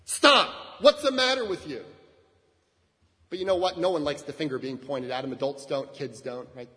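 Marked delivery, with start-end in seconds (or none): 0.0-1.8 put-on sharp, loud rebuke at a child; harsh scolding. Pastor acting out scolding a child for running in church, loud and sharp. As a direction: snap at the kid like a cross usher. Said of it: "You could stop them like this".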